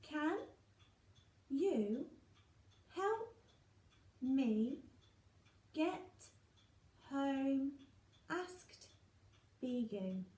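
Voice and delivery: each word spoken separately with pauses; counting words. Shown as a demonstration. Teacher counting words of a sentence with pupils, word by word. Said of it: "Let's count the words".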